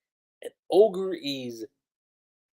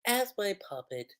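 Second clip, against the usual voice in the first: soft, slightly raised, offhand politeness; casual and polite; 4 semitones higher, 9 dB quieter than usual. Acted. Mikoto claiming the revived one, casually polite. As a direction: say it softly, politely, as if nothing. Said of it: "so casually, so polite".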